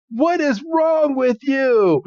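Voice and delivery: high, slow, drawn-out wail; hammed-up distress in a squealing voice; panicked mock shriek. Girl panics at boy over ice Pokemon, mock shriek. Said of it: "and just stammers. She's like".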